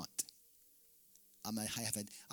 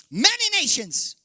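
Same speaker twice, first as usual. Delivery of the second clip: high, loud and slow; preaching shout; 13 dB louder than usual. Real, not acted. Preacher proclaiming to the congregation, slow loud cry. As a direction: ring it out over the hall.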